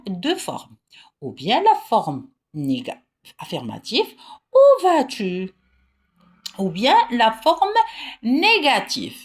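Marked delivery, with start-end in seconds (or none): 4.5-5.6 "Où vas-tu?" slow and loud, the voice lifted high on "Où"; teacher shows the question tune of "Où vas-tu?"; raised questioning voice. Shown as a demonstration. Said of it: "utiliser une voix montante".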